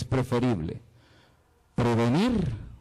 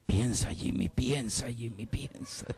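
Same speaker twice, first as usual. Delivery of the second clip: hushed thinking-to-himself voice, trailing away; Jimmy Neutron; 3 semitones lower, 7 dB quieter than usual. Acted. Preacher playing himself puzzling over a doubt, soft cartoon imitation. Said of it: "Como Jimmy Neutron".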